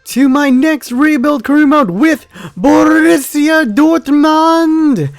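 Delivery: hyped YouTuber intro. Acted. Gamer welcoming viewers to the new series, hyped intro shout. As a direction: belt out the channel intro like an announcer.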